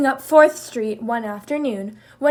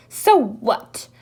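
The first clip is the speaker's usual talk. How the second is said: sniffling; 5 dB louder than usual. Acted. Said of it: "sniffled Jackal".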